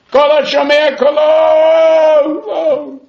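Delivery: slow, drawn-out yell, pitched high; long, loud, desperate cry up the shaft; retold scream from a well. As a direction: call for help from the bottom of a well. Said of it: "So he screams".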